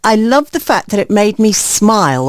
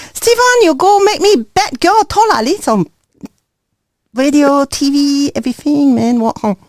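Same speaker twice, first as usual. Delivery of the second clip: Singlish, higher and bouncy; Singapore accent; 5 semitones higher than usual. Pretend. DJ asked on air to do her Singapore accent. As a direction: put on a playful Singapore accent for the listeners. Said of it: "give us a little bit so they can hear".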